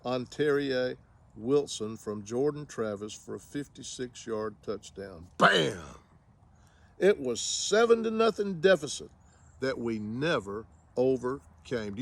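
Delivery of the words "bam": loud, punchy burst; abrupt raised-voice interjection; sudden impact exclamation. Pretend. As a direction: punch it out like a hit landing. Exclamation punctuating a touchdown.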